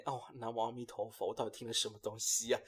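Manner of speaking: hushed mock prayer at her story; muttered prayer. Real, not acted. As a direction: pray under your breath at what you heard. Host mock-prays, shocked.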